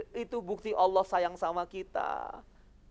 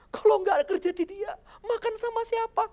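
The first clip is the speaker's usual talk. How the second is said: high, whiny mock panic; hammed-up pleading worry, much higher; 10 semitones higher than usual. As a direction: play the frightened believer with a pleading edge. Imitating a panicked jobless believer.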